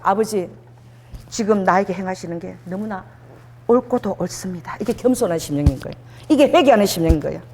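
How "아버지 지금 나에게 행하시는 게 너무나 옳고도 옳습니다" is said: low, soft, submissive prayer; subdued confession to the Father; humble prayer. Shown as a demonstration. Leading congregation in humble prayer.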